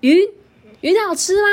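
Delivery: high, amazed little question; curious caterpillar. Acted. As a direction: squeak it like a curious bug. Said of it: "豆豆就很好奇说".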